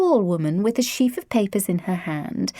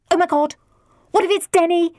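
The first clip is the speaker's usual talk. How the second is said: put-on high, louder teen panic; panicked cry; 10 semitones higher, 4 dB louder, faster than usual. A narrator's reading. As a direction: shriek it in a fluster. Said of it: "Lydia cried".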